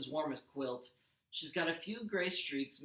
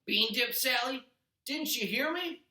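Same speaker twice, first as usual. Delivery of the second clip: higher, loud, slow impatient demand; Gabe yelling; 9 semitones higher, 6 dB louder, slower than usual. A narrator's reading. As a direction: bark at her for the dip. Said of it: "in the doorway and yelled".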